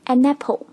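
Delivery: "an apple" joined into a-napple; linked. Shown as a demonstration. Teacher showing the two sounds joined, linked. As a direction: slide the n of "an" into "apple". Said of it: "拼到了一起".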